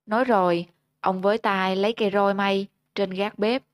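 ordinary delivery, nothing marked

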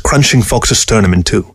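usual speaking voice

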